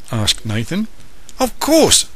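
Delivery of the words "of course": warm assent. Acted. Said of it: "said Nicholas".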